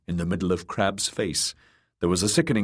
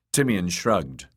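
ordinary delivery, nothing marked

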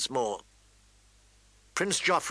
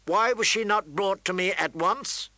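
higher, indignant question; pressing, raised-pitch demand; ringing demand; 6 semitones higher than usual. A narrator's reading. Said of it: "his voice ringing".

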